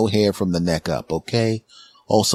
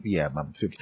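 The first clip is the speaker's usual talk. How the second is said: soft, low reply; 4 semitones lower, 9 dB quieter than usual. Retold or imitated.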